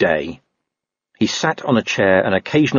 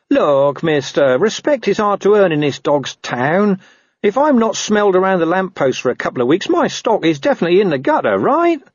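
high-pitched, wheedling whine; whining dog; 11 semitones higher than usual. A narrator's reading. Dog excusing himself to a watchman, whining. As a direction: plead your case in a doggy whine. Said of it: "Gaspode whined".

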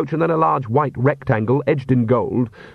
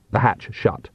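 usual speaking voice

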